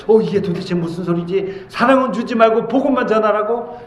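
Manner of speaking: high, incredulous questioning; bewildered disbelief. Retold or imitated. Pastor reacts inwardly to advice to preach without love, bewildered. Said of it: "머릿속에 혼란이 와요".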